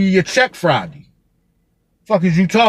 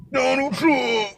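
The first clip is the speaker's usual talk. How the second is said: high, soft "Donald Trump" with the stress on Donald; mock repeat; 9 semitones higher, 6 dB quieter, slower than usual. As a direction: toss the name off high and quiet. Repeats the name to himself.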